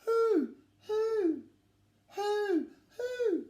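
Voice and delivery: very high, drawn-out ghostly hoot; spooky who call. Acted. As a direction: hoot it like a haunting owl. Imitating the owl's call.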